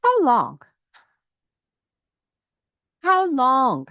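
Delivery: a grieved God pressing Moses, slowed at the end; voicing God. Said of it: "then the Lord said to Moses".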